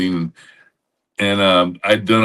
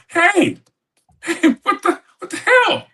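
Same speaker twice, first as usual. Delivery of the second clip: mock outrage. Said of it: "Now I'm pissed".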